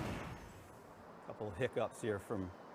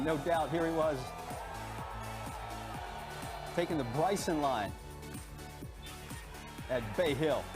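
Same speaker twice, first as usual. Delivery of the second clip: dramatic feature narration; 5 semitones higher than usual. Acted. Feature story over music.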